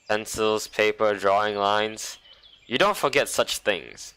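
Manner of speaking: usual speaking voice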